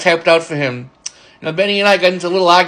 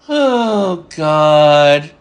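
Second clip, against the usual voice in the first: drawn-out exasperated groan of words, imitated; exasperated coworker; 3 semitones lower, 3 dB louder than usual. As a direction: sigh it out long, dropping onto a held last word. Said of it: "He'd always do that".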